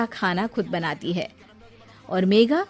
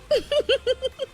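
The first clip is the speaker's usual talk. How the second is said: high gloating laugh; mocking laugh; 3 dB quieter than usual. Daughter-in-law laughing at her mother-in-law, high and gloating.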